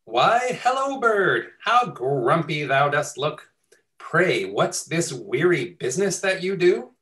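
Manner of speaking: host voicing Sheep greeting Bird in mock Shakespearean verse; cheery sheep. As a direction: greet the grumpy bird like a chirpy, nosy neighbour.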